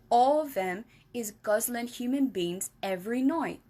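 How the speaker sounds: lightly voiced friendly giant explaining; BFG voice. Giant explaining to Sophie, lightly voiced.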